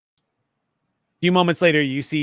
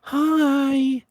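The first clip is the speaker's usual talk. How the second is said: very high, drawn-out hello; cutesy greeting; 13 semitones higher than usual. Acted. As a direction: coo as if to a tiny pet. Tara greets a cute pet.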